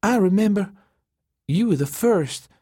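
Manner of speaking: high, thin accusing tone. A narrator's reading. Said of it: "she said in a thin voice".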